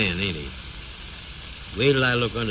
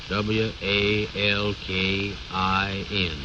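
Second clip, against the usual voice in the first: slow spelling out; much slower than usual. Acted. Slowly spelling out sign letters.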